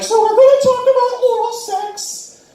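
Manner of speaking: high-pitched imitation of her announcing the topic; put-on high voice, introducing an awkward lesson; mock sex-talk presenter. She announces the topic to the group, mock high-pitched presenter. Quoting the woman giving the talk.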